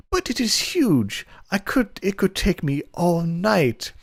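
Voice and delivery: hammed-up high flustered servant; shocked coy maid. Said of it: "inappropriate voice I can muster".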